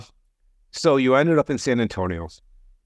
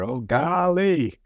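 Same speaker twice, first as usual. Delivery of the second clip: Gomer Pyle; 7 semitones higher, much slower than usual. Retold or imitated. Host quoting Gomer Pyle to the guest, slow and high. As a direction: stretch it slow like a TV country bumpkin. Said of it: "the reference to Gomer Pyle".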